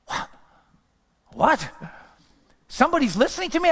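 high and fast, amazed that anyone listens; stunned disbelief. Retold or imitated. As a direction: burst out in astonished disbelief. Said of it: "I go".

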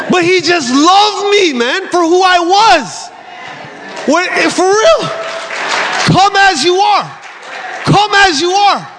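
high, drawn-out heartfelt declaration; impassioned preaching. Real, not acted. Impassioned preaching at full force.

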